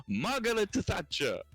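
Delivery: high, drawn-out mock call of a passing cyclist; high-pitched, slow imitation of a stranger's call; Italian cyclist. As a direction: imitate an Italian man calling out a name slowly. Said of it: "he points again and goes".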